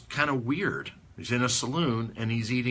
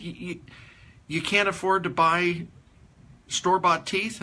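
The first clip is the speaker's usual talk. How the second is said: incredulous; 7 semitones higher than usual. Retold or imitated. Father incredulous.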